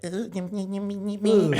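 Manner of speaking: put-on high, babyish begging; squeaky little-kid pleading, hammed up; mock baby talk. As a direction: imitate a lover talking like a toddler. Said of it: "It makes you feel like a fucking predator".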